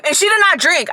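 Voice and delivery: usual speaking voice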